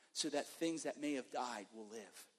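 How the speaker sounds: usual speaking voice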